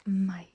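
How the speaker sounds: "Meg" said like English "my"; correct pronunciation. Shown as a demonstration. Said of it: "but it's pronounced".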